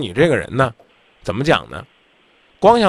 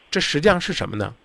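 normal talking voice

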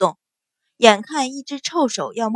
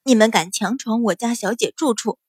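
ordinary delivery, nothing marked

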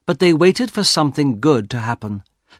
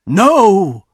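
loud, high-pitched refusal; horrified cry; 8 semitones higher, 8 dB louder than usual. A narrator's reading. Husband refuses wife's wish, loud cry. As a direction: cry out in protest.

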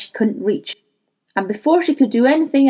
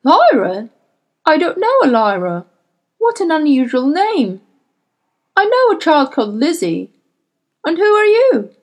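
put-on a suave older gentleman, raised and feigning surprise; smooth gentleman; 6 semitones higher, 5 dB louder than usual. A narrator's reading. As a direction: play the polished host who pretends not to know her. Sir Charles voiced by narrator.

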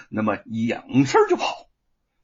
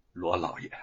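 soft, lowered confidential address; low-voiced aside; 7 semitones lower, 6 dB quieter than usual. A narrator's reading. Magistrate tugging Luo Pang's sleeve, low-voiced aside. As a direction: murmur it discreetly. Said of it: "小声地说".

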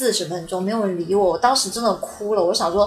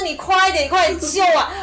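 high, urgent plea with a laugh; frantic pleading; 8 semitones higher than usual. Retold or imitated. Trapped woman urging the police to hurry, high-pitched and half laughing.

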